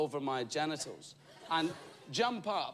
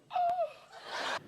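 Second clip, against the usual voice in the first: put-on old ladies gasping. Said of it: "Lots of old ladies going".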